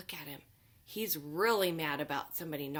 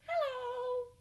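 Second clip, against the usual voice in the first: much higher playful mask voice; squeaky monster hello. Acted. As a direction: greet the kids as the pink monster.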